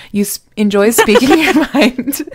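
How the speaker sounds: laughing through the line. Real, not acted. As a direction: crack up mid sentence. Laughs mid reading.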